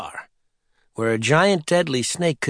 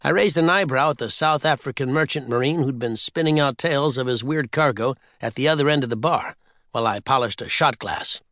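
plain reading voice

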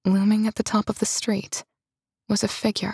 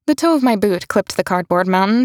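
plain reading voice

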